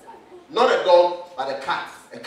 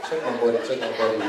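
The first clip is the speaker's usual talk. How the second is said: low seductive voice; 9 semitones lower, 6 dB quieter, faster than usual. Pretend. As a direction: purr it like a flirty cat. Cat flirting with a guest.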